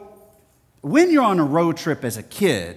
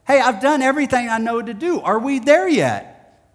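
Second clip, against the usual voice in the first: put-on high, loud child whine, slowing at the end; bored boy pleading from the back seat; exasperated kid; 9 semitones higher, 5 dB louder than usual. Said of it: "Later, I'd go".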